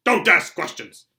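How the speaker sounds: loud, slow snap; snapped. Acted. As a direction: bite the words off. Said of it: "snapped his uncle".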